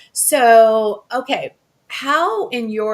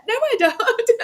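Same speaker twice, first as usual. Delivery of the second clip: laughing it off, higher pitch; laughing denial. Real, not acted. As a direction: giggle through the denial. Flattered, denying she has accent.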